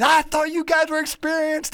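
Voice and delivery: high, loud angry boss outburst; retold yelling. Restaurant owner yelling at the crew over the broken window, high and loud. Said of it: "he starts yelling at me".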